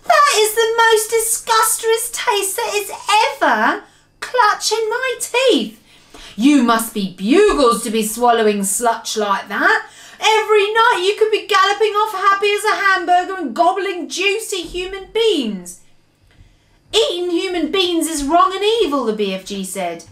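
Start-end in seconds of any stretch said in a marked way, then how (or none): 0.0-15.8 high-pitched giant rant; outraged yelling. A narrator's reading. Giant rails about foul food, outraged. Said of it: "yelled the blood bottler". 16.9-19.0 high-pitched moral protest; earnest rebuke. A narrator's reading. BFG rebukes the man-eating giant, earnestly.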